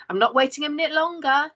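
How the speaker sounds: calling out. Acted. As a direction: Nana calling up to the room, impatient. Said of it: "Nana calls".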